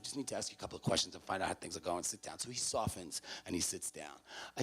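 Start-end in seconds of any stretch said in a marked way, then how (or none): 0.0-2.4 low, soft, easy reassurance; soothing. Retold or imitated. Staffer calming an upset student in the office, soothing. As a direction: settle the listener down with a lowered voice.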